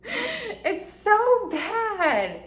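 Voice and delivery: high and very slow, stretching every word; whiny drawn-out wail. Real, not acted. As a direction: moan it like a cringing confession. Laughing at her own behaviour.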